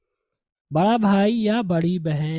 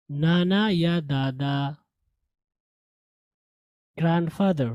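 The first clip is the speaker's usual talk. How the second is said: usual speaking voice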